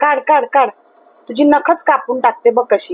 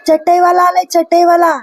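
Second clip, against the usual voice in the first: vendor call; 5 semitones higher than usual. Retold or imitated. Repeats the vendor call, higher.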